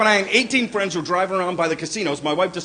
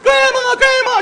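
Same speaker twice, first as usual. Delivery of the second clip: put-on high wailing child's cry; 7 dB louder, slower than usual. Granddad imitating crying granddaughter calling Grandma, high wail. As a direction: wail it like an upset little girl.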